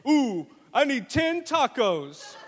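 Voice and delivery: hamming up dad's hunger; hungry dad. Retold or imitated. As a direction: moan for tacos, slowly. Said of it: "He said".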